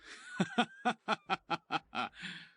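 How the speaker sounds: big hearty laugh demo; hefty laugh. Shown as a demonstration. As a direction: let out a full, hearty laugh. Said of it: "I have quite the hefty laugh".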